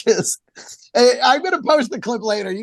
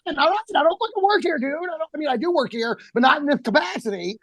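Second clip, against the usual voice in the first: exasperated; 7 semitones higher, faster than usual. Retold or imitated. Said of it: "And I'm like".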